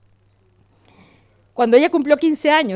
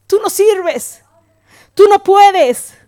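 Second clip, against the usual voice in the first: loud, raised taunt as the devil; accusing devil; 4 semitones higher, 6 dB louder, slower than usual. Acted. The devil tells a fallen believer they are useless, loud accusing taunt. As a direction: hammer each accusation slowly, higher and louder. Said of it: "el diablo dice".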